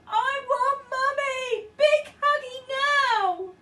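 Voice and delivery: high, loud, slow wailing tantrum; crying toddler. A narrator's reading. Baby carried off, wailing tantrum. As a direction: wail it like a sobbing toddler.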